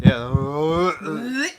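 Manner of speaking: drawn-out undead moan on the yeah; zombie groan. Pretend. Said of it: "The zombie groan".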